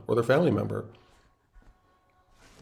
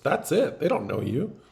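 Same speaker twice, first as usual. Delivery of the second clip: ordinary talk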